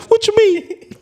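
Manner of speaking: teary squeak again. Retold or imitated. Showing the listener exactly how he squeaked it to Cam. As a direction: repeat it the same tearful way. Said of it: "Just like that".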